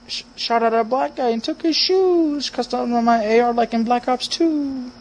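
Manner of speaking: high, mocking voice of a friend; mocking impression. Gamer mocking a friend's rap, high and silly. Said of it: "That's how he sounds".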